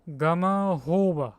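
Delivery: "Gamahoba" with a breathy H starting "hoba"; H pronunciation. Shown as a demonstration. Streamer trying a chat word, "Gamahoba" with an H sound. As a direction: say "Gamahoba" slowly, with HO in the third syllable. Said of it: "So it's not H".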